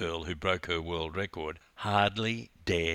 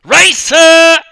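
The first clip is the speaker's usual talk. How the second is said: Racer screamed much higher and louder, stretched out; screaming; 23 dB louder than usual. Acted. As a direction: yell Racer across the whole pool. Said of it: "I screamed even louder".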